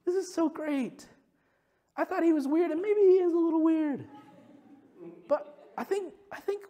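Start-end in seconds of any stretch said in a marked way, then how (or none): 0.1-1.1 much higher, slow awed wow; lightly voiced childlike awe; wonder. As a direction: savor the amazement. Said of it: "I've become more".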